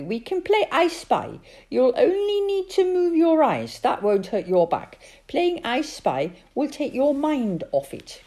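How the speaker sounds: put-on high, loud child's voice; little girl voice. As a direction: ask like a worried small girl.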